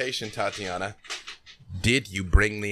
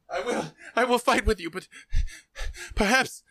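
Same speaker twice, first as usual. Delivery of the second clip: much higher, fast, urgent plea; pleading brother Armand; 11 semitones higher, faster than usual. Acted. Armand begs his brother to flee, high and fast. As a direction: rush the words out, begging him to back down. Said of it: "he puts a hand on your arm and he says".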